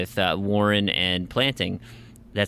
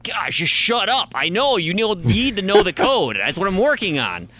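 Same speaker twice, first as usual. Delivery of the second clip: high-pitched, fed-up retort to a nag; mock exasperation, pitch shooting up; exasperated outburst; 9 semitones higher, 3 dB louder than usual. Retells snapping at the nagging game.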